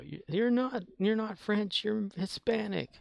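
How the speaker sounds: imitating the family. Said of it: "But he kept saying it, though".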